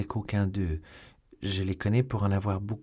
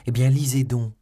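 loud, higher, triumphant urging; proud joy; 4 semitones higher, 7 dB louder than usual. A narrator's reading. Young woman hands Milady the letter, proudly joyful. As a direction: urge it with proud delight. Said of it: "dit dans l'excès de son orgueil et de sa joie".

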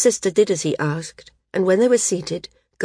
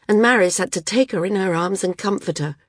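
ordinary delivery, nothing marked

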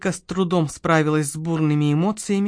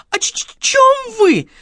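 very high, tearful stutter; slow whimpering stutter; terrified stammer; 14 semitones higher, 4 dB louder than usual. A narrator's reading. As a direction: squeak out the question, stuck on the consonant. Terrified shop girl stammers back.

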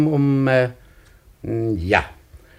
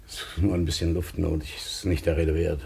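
breathless; 10 semitones lower, 6 dB quieter than usual. Acted. Recovering from breathlessness.

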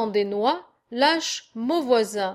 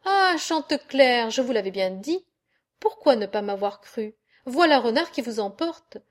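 ordinary delivery, nothing marked

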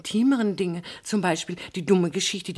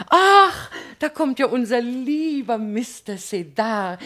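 high, loud delighted cry, then slower; gushing greeting; 7 semitones higher, 5 dB louder, slower than usual. Acted. Mrs Garrison greeting Mister Sedar, gushing high-pitched delight. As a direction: gush over the newcomer. Society lady greets arriving lawyer.

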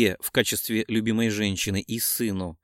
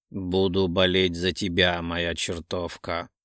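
contented purr; 6 semitones lower, slower than usual. A narrator's reading. Pleased Илья cheering on his lover, a low contented purr. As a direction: purr it low, letting the endearment drop away. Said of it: "муркнул довольный Илья".

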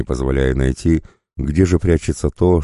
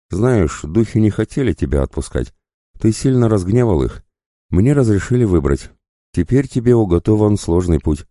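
higher-pitched voice for a character; Лана's voice; 7 semitones higher than usual. Acted. Лана tells the waking wolf of the spirits' verdict, higher-pitched.

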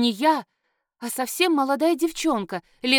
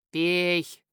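low growl; 5 semitones lower than usual. A narrator's reading. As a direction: lower growled command. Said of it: "низким голосом прорычали". (низкий рычащий приказ)

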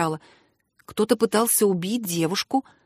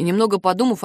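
plain reading voice

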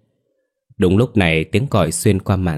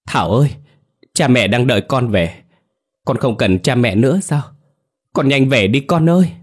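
raised, higher voice calling out; pleading call; 5 semitones higher, 5 dB louder than usual. A narrator's reading. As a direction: plead with a lost child to come home, building to a loud cry. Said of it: "lớn tiếng gọi". (cất cao giọng gọi con tha thiết)

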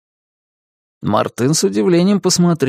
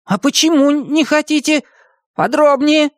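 high-pitched insistent questioning; pushy little hero; demanding; 14 semitones higher than usual. A narrator's reading. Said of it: "потребовал Бартоломео".

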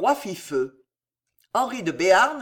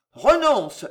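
loud, raised-pitch ultimatum; yelled command; 4 semitones higher, 6 dB louder than usual. A narrator's reading. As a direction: yell the order at him. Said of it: "hurla-t-il à nouveau".

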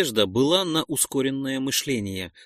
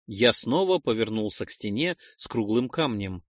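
plain reading voice

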